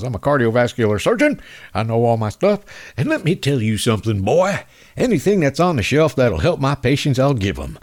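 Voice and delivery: mock the bragging Kentucky doctor in a southern accent. Host mocks a Kentucky surgeon, light southern accent. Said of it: "God, that southern accent".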